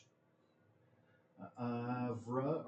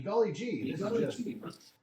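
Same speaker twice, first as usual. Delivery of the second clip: higher, sugary mock-polite exclamation; sweet and innocent, covering a curse; fake wholesome; 3 semitones higher, 6 dB louder than usual. Sanitized retelling of swearing at game.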